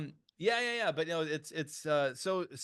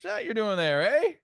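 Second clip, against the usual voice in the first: hammed-up Canadian accent, climbing higher and louder to the eh; 7 semitones higher, 4 dB louder than usual. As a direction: play up the Canadian accent, landing on a big rising eh. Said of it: "in my Canadian accent".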